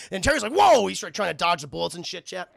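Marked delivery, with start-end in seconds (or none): 0.5-0.9 high, loud cry of alarm; startled yelp. Acted. Terry dodging machine gun bullets, high startled yell. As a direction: yelp as if suddenly shot at.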